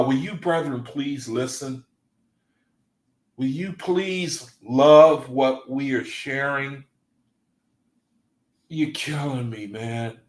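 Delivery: ordinary talk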